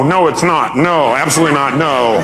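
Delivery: normal talking voice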